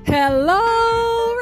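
ordinary talk

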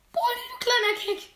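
high, loud gamer outburst; exasperated yell. Real, not acted. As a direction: yell an insult at the game, pitch way up. Cursing the game opponent. (genervtes Schreien)

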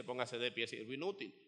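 low, soft version of the same order; said after a smile. Shown as a demonstration. Servant telling the church to stand, softly and calmly. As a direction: say it gently, after smiling first. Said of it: "Pero empiece con una sonrisa".

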